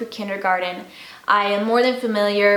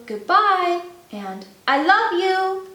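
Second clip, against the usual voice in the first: gentle; 9 semitones higher, slower than usual. Acted. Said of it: "with a gentle".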